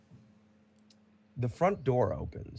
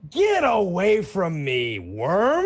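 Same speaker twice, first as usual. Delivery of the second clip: high, loud, slow contemptuous bellow; angry shout; 9 semitones higher, 8 dB louder, much slower than usual. Acted. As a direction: roar it down at the servant. Bruno shoves the servant away.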